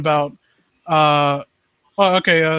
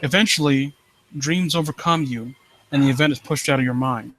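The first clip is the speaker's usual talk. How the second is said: low, hushed creepy-story reading; story narration; 3 semitones lower than usual. Acted. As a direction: draw the listener into the dread. Reads creepy story aloud.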